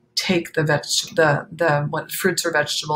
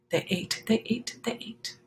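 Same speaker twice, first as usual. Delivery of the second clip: softer and softer into a whisper; fading refrain; 4 semitones higher, 7 dB quieter than usual. A narrator's reading. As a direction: let it fade away to a whisper.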